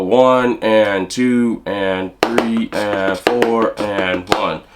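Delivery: slow, spaced-out counting in time. Shown as a demonstration. Drum teacher counting the bar slowly for students. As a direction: count it out slowly over the beat. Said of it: "a little slower".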